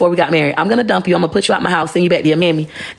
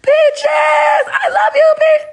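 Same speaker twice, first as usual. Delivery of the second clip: husband calling out; much slower than usual. Pretend. Husband begging for her back, shouting high. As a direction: belt it up high like a lovesick man begging. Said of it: "he gonna be like".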